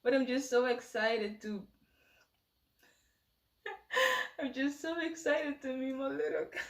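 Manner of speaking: excited words through rising tears; on the verge of happy tears; tearful excitement. Real, not acted. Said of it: "Why am I crying?".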